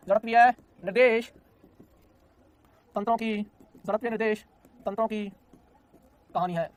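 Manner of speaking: जड़त्वीय निर्देश तंत्रों की कहानी है, each word set apart by long pauses; word-by-word dramatic announcement to the class; dramatic storyteller pause. Shown as a demonstration. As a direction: draw it out like a story title. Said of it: "मैं तुझे feel कराऊंगा बेटा जी".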